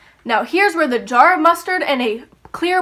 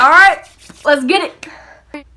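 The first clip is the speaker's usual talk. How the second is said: louder then slow stretched hype; hyped; 2 semitones higher, 6 dB louder, slower than usual. Retold or imitated. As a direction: cheer for the spaghetti. Excited about lunch.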